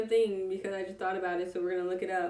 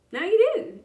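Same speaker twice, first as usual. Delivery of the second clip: playful; 11 semitones higher, 8 dB louder than usual. Acted. Punchline after "you didn't need to know.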